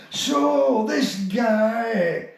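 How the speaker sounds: a hint of Billy Connolly drawl. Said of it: "he's going".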